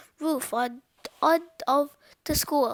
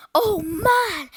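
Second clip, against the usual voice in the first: loud, higher exclamation; big storybook "oh, man"; amazed cry; 5 semitones higher, 9 dB louder than usual. A narrator's reading. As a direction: cry out in astonished relief. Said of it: "cried Cheeseball".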